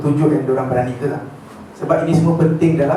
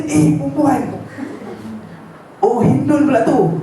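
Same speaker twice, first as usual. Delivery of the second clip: higher, slow, laughing surprise at spotting a woman; surprised recognition; 8 semitones higher, slower than usual. Retold or imitated. Abu Dujana spotting Hindun in battle, higher surprised laughing exclamation. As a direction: pull back the blow with a surprised laugh.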